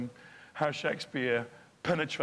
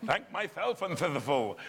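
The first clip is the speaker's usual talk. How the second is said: mock posh Shakespeare; 3 semitones higher than usual. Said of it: "talking like".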